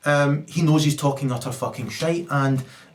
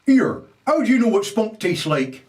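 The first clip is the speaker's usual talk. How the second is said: mock father; 8 semitones higher, 4 dB louder than usual. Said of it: "her father says".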